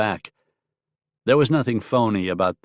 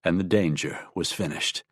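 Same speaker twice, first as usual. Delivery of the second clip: ordinary delivery, nothing marked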